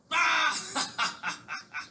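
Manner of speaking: high, hammed-up mocking laugh from Caesar. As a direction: laugh in the pirates' faces, high and big. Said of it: "young Julius Caesar laughed at their faces".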